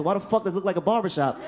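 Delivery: normal talking voice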